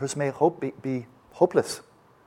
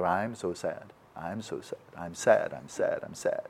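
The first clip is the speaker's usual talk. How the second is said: mopey I'm sad, sinking lower and softer; wallow, trailing off into a lower mumble; mock self-pity fading; 3 semitones lower, 5 dB quieter, slower than usual. Teacher mocking half-baked compassion, fading self-pity. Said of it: "It's like".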